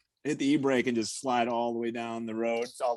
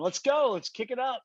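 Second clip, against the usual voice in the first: egging on; 12 semitones higher than usual. Pretend. Egging on the driver, imagined.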